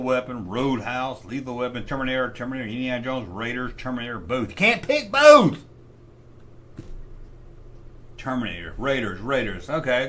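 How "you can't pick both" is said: exasperated outburst. Real, not acted. Arguing with himself over bracket.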